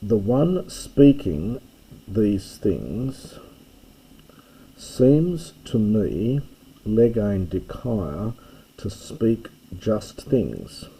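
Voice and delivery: slow, careful construe with pauses between phrases; slow word-by-word rendering. Shown as a demonstration. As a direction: spell it out slowly, phrase by phrase, "seems" drawn out. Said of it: "do that bit again quickly".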